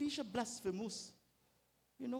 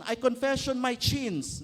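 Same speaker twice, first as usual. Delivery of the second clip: slow, loud, laughing through a parody prayer; hammed-up confession with a laugh; laughing mock prayer; 4 semitones higher, 9 dB louder than usual. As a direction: giggle while reciting a rote confession. Mock formulaic ACTS prayer.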